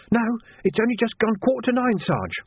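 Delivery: high-pitched eager junior voice; young constable. A narrator's reading. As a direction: report to the sergeant in a high voice. Young constable answering his sergeant.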